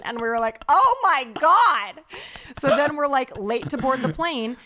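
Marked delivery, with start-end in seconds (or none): 0.7-2.0 loud, slow, higher-pitched retold exclamation; exasperated outcry. Passengers reacting to a boarding refusal, exasperated. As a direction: stretch it out in mock disbelief.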